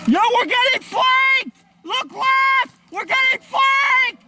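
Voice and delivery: high, urgent warning yell; alarmed shout. Real, not acted. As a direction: warn the team, urgent. Alarm, team being flanked.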